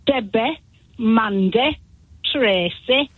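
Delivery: register call with broad Nottingham vowels; Nottingham accent. Retold or imitated. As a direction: imitate a Nottingham roll call, name by name. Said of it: "call the register in Nottingham and go ... like this".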